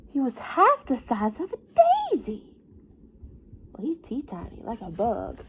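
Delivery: put-on lively storybook reading for kids; reading to children. A narrator's reading. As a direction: read it like story time for a class. Teacher reads picture book aloud.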